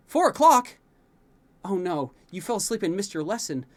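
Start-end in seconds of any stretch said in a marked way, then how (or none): 0.1-2.1 much higher, louder startled cry; sudden panic at the time; alarmed surprise. A narrator's reading. Prince realising he overslept, alarmed cry.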